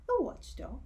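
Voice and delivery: lightly puzzled question; quizzical. Acted. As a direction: ask it as if unsure.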